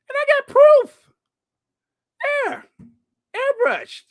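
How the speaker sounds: squeaky, very high indignant cry; mock outrage. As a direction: squeal it as a hammed-up accusation. Mock outrage over airbrushing evidence.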